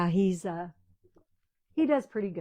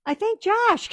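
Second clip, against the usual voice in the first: much higher and louder, laughing-edged reply; flustered answer; 13 semitones higher, 10 dB louder, slower than usual. Retold or imitated. Wife answering her husband's quizzing, flustered and loud. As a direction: blurt it out, caught off guard. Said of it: "I go".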